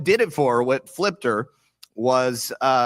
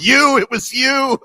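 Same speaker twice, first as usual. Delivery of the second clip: much higher, louder, slow and laughing; laughing accusation; 13 semitones higher, 8 dB louder than usual. Retold or imitated. Daughter quoting her mom blaming her for Trump, laughing. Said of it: "she was like".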